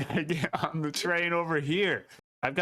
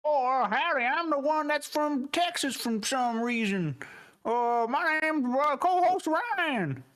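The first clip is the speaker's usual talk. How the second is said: put-on Texan accent; 10 semitones higher, slower than usual. Introduces himself as Texan vampire.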